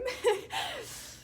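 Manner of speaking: a high burst of real laughter; high, genuine giggle. Real, not acted. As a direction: laugh out at the memory, high-pitched. Laughing at the cry room.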